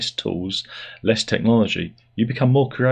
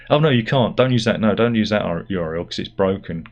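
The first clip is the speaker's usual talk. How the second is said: ordinary talk, nothing marked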